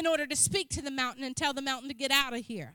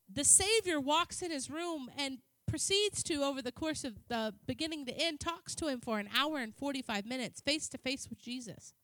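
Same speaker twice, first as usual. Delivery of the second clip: usual speaking voice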